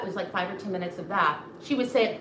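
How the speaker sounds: normal talking voice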